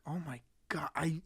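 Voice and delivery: breathless whisper of awe; barely voiced, stunned by the scene; hushed "oh my God" whisper; awed whisper. Awed reaction to the movie.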